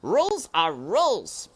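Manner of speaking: high, loud flat refusal; high, loud mouse refusing; dismissive brother mouse. A narrator's reading. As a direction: shut him down firmly. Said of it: "said Furlough".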